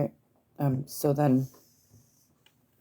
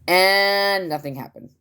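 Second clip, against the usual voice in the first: mock disappointment; 6 semitones higher, 4 dB louder, slower than usual. Real, not acted. Deadpan letdown punchline.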